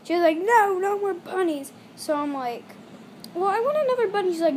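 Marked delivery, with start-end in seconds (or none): 0.4-1.7 firm, slow, higher mock mom; lightly playing a stern mom; stern mom refusal. 3.3-4.3 higher, louder whiny demand; hammed-up pleading child; whiny kid. Kid whining, retold.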